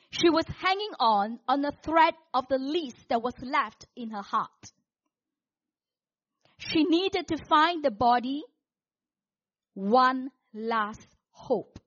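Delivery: loud, measured, suspenseful storytelling; dramatic preached narration. Acted. Preacher telling the congregation of Mary at dawn, dramatic narration. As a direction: declaim the story with mounting drama.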